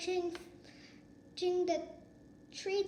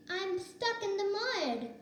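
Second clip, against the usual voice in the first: high, small voice; baby elephant; 7 semitones higher than usual. A narrator's reading. Baby elephant telling the cubs, high and small. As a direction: sound young and stuck. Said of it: "the elephant called".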